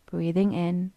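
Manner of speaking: unhurried, even delivery, leaving room to breathe; guided meditation. Acted. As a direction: guide them gently into stillness, phrase by phrase.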